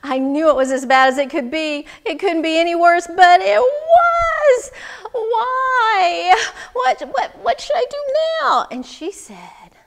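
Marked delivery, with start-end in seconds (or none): 0.0-8.7 building from insistent to high, loud wail; desperate complaint. Acted. Man begging wise woman for advice, increasingly desperate. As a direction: let it climb into an anguished plea.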